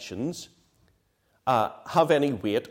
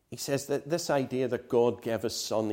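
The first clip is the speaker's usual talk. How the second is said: usual speaking voice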